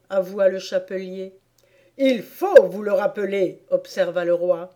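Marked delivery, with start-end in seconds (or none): 2.0-3.6 loud royal order; loud, stern demand; commanding King. Acted. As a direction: insist firmly from the bench.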